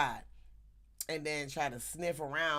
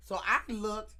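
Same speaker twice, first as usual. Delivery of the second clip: ordinary delivery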